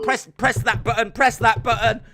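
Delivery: high, loud, shrieking panic, building; hammed-up hysterical yelling; mock panic. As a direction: act out a frantic stagehand meltdown. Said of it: "What I just reenacted there".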